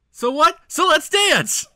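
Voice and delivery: high, loud, excited yell; excited shout. Pretend. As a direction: belt it out like a party cry. Sung [name] quote excitedly.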